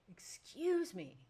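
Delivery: drawn-out "Excuse me" called slowly across the gym; calling out loudly. Retold or imitated. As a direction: stretch it out to be heard over her. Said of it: "at the top of my lungs".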